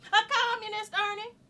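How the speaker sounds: high, loud exclamation; indignant exclamation. Acted. Woman telling Ernie what town called her, indignant exclamation. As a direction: call out the label in outrage.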